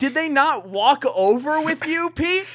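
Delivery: high, disbelieving question; incredulous. Retold or imitated. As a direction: ask it in mock disbelief. Mock bewildered question to Pete.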